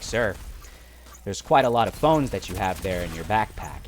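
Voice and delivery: low, measured mock official; serious officer. Officer questioning traveler over many phones, low serious imitation. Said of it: "he gets super serious".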